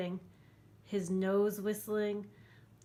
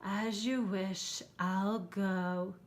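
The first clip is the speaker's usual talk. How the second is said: slow resigned monster; much slower than usual. Acted. Herbert leaves.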